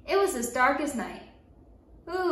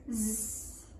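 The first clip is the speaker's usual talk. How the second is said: soft, buzzing storybook snore; imitated snoring; 3 semitones lower, 11 dB quieter than usual. A narrator's reading. Storyteller imitating a daddy lion asleep in grass, snoring. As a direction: buzz quietly like a sleeping lion. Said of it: "a daddy lion snoring in the grass".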